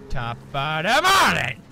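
loud, slow bellow; bellowed catchphrase. Retold or imitated. Shouting Jacksepticeye's greeting at the game, loud and slow.

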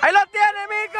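very high, urgent yell; excited shout. Real, not acted. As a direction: yell at a player with a clear chance. Shouting as a player has the chance. (grito agudo y emocionado)

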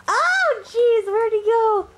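startled panic as it vanishes; alarmed. Real, not acted.

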